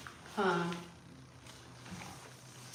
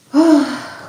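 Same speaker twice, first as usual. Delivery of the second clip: big relieved sigh; 7 semitones higher, 15 dB louder than usual. Real, not acted. Admiring the freshly cleaned sink.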